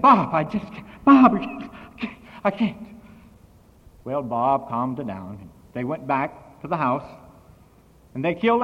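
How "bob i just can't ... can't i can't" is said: loud, high, breathless cry for Bob; imitated scream. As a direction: act out her panicked screaming, breaking off mid-plea. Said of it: "She came over there screaming and said".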